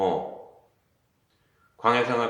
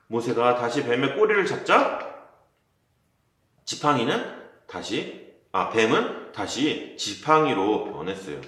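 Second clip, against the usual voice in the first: ordinary delivery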